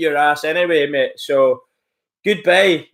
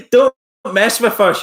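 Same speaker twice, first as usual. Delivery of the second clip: imitating the troll's threat, pitched up and louder; mocking hard man; 5 semitones higher, 5 dB louder than usual. Streamer mocks the troll's threat, loud sneering imitation. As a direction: ham up a wannabe hooligan's threat. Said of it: "You're a hooligan. You're hard as fuck".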